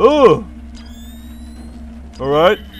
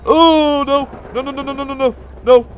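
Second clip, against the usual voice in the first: panicked murmur; 5 semitones higher than usual. Real, not acted. Chickens reach the ship.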